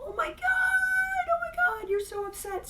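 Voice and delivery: high, slow, fussing coo; mock fussing. Loved ones fussing over a crying mom, high and cooing. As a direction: gush over the crying person in a put-on high voice. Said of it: "doesn't everybody go".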